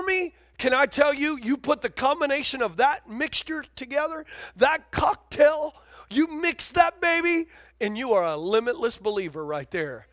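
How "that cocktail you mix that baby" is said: slow preacher's shout over the crowd; slow shouted emphasis. Acted. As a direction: draw each word out as you shout.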